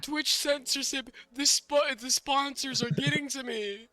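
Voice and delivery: mock panic. Mock panic over censorship.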